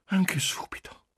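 high, whispery daring; eager, brazen whisper; bold. A narrator's reading. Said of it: "ella disse arditamente".

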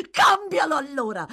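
loud, high contemptuous laugh into a taunt; mocking cackle, spit the dare; scornful laugh. A narrator's reading. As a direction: laugh in his face, then dare him. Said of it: "con una risata sprezzante".

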